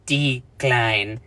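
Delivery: "decline" said like "dick-line" with a hard t; "decline" blurred toward "dickline"; mispronounced decline. Shown as a demonstration. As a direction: slur "decline" into "tickline". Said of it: "it sounded like D I C K L I N E".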